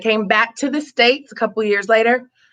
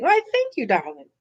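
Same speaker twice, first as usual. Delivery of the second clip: put-on British accent; 5 dB quieter than usual. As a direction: answer the compliment in a mock British lilt. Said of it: "love the accent on point".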